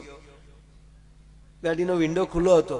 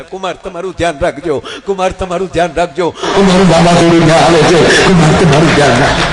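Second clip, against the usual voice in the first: "કુમાર તમારું ધ્યાન રાખજો" yelled much louder as a threat; mock warning shout; 3 semitones higher, 15 dB louder than usual. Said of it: "મને આજ ખબર પડી તમે મને કહેતા હતા". ("તમારું ધ્યાન રાખજો" જોરથી ધમકીની જેમ)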